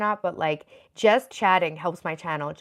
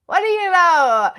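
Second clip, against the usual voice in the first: laughing higher and slower, louder; 11 semitones higher, 11 dB louder, slower than usual. Real, not acted. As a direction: stretch What do you know up high through a laugh.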